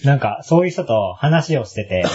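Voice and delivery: ordinary delivery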